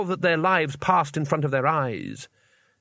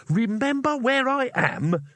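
indignant shout; 11 semitones higher, slower than usual. A narrator's reading. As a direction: stretch the question out in high-pitched outrage. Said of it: "shouted Rincewind".

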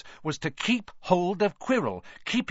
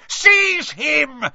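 shrill shriek, much higher and louder; 12 semitones higher, 9 dB louder than usual. A narrator's reading. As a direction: shriek the order in rage. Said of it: "shrieked Voldemort again".

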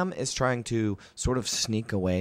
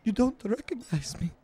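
much higher, eager stammering writer; Volo; 12 semitones higher than usual. Acted. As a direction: fuss and fluster like a pompous little chronicler. Volo again.

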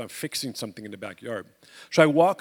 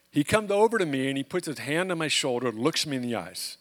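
ordinary talk, nothing marked

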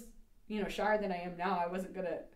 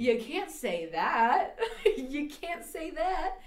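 slow, loud scolding through laughter; high, drawn-out mock outrage, breaking into laughs; laughing disbelief; 8 semitones higher, 6 dB louder, much slower than usual. Scandalized student chiding the rude kid, laughing in disbelief. Reacting to the kid's rude remark.

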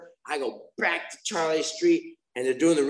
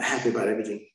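usual speaking voice

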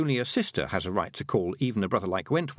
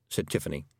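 ordinary delivery, nothing marked

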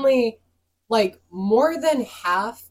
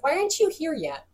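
high, whiny mock customer voice; lightly voiced nagging customer, pitched up; imitating impatient customer; 7 semitones higher, 4 dB quieter than usual. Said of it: "the person texts me like".

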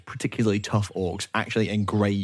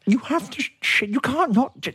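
high-pitched scolding; 14 semitones higher than usual. Acted. Mum scolding about the toilet.

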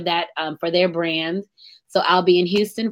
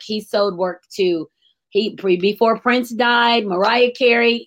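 normal talking voice

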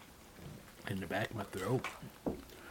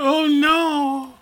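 high, loud pained cry; pained wail; 10 dB louder than usual. Real, not acted. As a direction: wail it from the burning heat. Still burning from hot wing.

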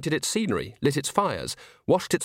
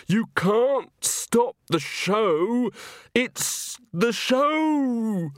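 much higher, very slow anguished cry; frantic wail; 9 semitones higher, much slower than usual. A narrator's reading. Walter crying to Granny that the show cannot stop, frantic. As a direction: plead like a man breaking.

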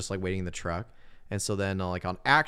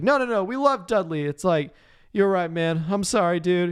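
high mock pleading voice; 9 semitones higher, 5 dB louder than usual. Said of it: "He's like".

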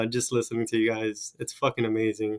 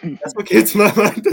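loud, high, through laughter; laughing admission; 9 semitones higher, 9 dB louder than usual. Real, not acted. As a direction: blurt it out laughing. Laughs at own risqué name suggestion.

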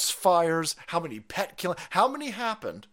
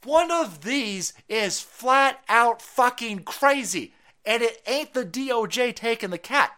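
angry emphasis; 6 semitones higher, 4 dB louder, slower than usual. Real, not acted. Outraged emphasis.

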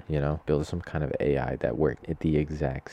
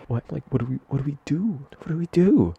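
much higher, rising panic, louder at the end; panicked; 10 semitones higher, 6 dB louder than usual. Retold or imitated. Supervisor panicking at the dead bird, high and frantic. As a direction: flap like you have no idea what to do.

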